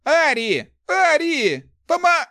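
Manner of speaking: high, loud cries for help; desperate calling. A narrator's reading. Thief calling Ari back for help, desperate shouting.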